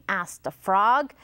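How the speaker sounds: plain reading voice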